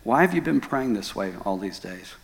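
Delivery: lightly voiced God, low and soft; God's reply. God questioning the distraught husband, low and calm. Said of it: "God said".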